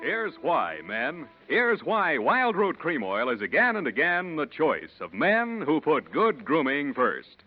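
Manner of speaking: usual speaking voice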